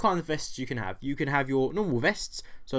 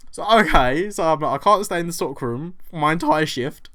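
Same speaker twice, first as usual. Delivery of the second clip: ordinary delivery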